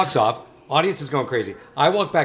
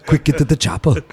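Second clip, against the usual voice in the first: put-on louder Austrian-accented action-hero command; Arnold Schwarzenegger impression; 3 semitones higher, 4 dB louder than usual. Action-hero line for the host, in a thick Austrian accent. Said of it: "How did you develop the Arnold Schwarzenegger?".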